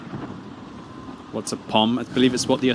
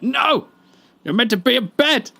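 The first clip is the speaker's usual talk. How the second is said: high, loud mock shriek; playful fake panic at a late viewer; mock outrage; 13 semitones higher, 4 dB louder than usual. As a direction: squeal it like you're caught out.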